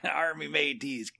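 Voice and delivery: slow, laughing pirate greeting; drawn-out hammy pirate hail. Pretend. Pirate joke banter.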